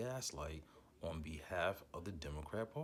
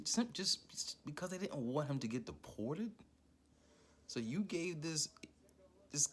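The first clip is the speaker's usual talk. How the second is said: real rising anger; 6 semitones higher than usual. Real, not acted. Commentator losing his temper at the pardon. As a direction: let the outrage spill over. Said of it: "calm down, [name]".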